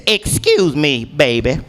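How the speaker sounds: mildly hammed-up boozy old-lady voice; drunk old lady. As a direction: imitate a tipsy old lady asking for help. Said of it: "her drunk ass wobbles over to me".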